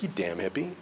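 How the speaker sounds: low-key, soft mock insult; softly imitated grudging comeback; grumbled retort. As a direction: grumble it quietly under the story. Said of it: "And I'm like".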